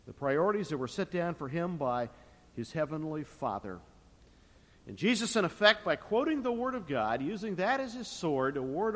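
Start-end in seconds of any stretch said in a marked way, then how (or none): none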